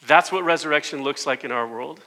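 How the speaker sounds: ordinary talk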